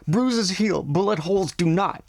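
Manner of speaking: high, sharp retort; angry snap. A narrator's reading. Nadia snapping at her brother about the soldiers. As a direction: cut him off hard. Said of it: "snapped Nadia angrily".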